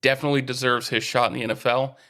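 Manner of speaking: normal talking voice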